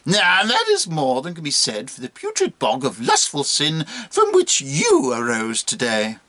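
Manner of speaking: swooping monkey harangue with high peaks; contemptuous monkey. Acted. Monkey cursing the old bishop, theatrical contempt. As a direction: build the taunt in theatrical, stretched phrases.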